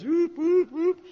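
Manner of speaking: high, loud whoops; whooping sign noise. Shown as a demonstration. Teacher mimics gaudy stars by the verse, whooping high. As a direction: whoop like a flashing storefront sign. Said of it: "little stars like Honest Ed's".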